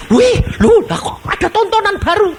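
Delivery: very high, loud excited gawking; crowd in heaven. Acted. Heaven's onlookers cheer at the sinner's exposed sins, high and excited. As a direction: gasp high at the new show.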